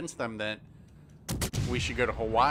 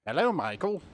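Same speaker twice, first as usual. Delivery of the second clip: put-on higher British-accented greeting, lifting at the end; Kit; 4 semitones higher than usual. Player greets Michael as the car, put-on British accent. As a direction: imitate a British-voiced talking car. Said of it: "have a British accent".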